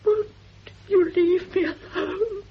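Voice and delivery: crying, slow and broken; tearful pleading. Acted. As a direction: beg her to stop through tears. Frightened woman pleads, crying.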